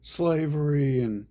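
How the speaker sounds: high, loud mock wail; whiny parody of people crying about the world; mock complaining whine; mocking crybaby voice. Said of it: "They're like".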